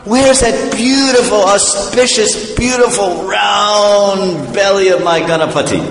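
seek your beloved deity in a high, stretched voice; yearning devotee. Acted. Devotee seeking his deity.

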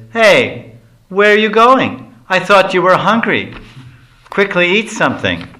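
high, loud mocking challenge; teasing call. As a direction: shout after him, teasing him to eat. Lalita stops him with stick.

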